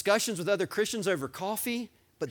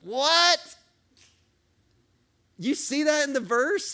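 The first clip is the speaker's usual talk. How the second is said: loud, much higher cry of amazement; astonished outburst; 10 semitones higher, 7 dB louder, slower than usual. Real, not acted. Preacher marvels at the verse to his church, loud and high. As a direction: shout it like you can't believe it.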